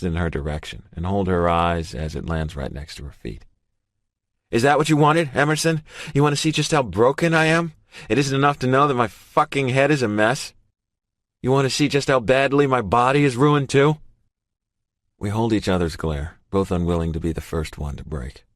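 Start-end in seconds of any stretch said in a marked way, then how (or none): 4.5-14.0 loud bitter raised-voice outburst; angry. A narrator's reading. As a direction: hurl the words in shame and rage. Maddox throws his prosthetic, furious.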